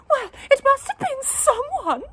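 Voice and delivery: very high, loud, sniffing retort; sniffy wife. A narrator's reading. Wife blaming someone, high and sniffy. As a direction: sniff it out haughtily. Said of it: "sniffed his wife".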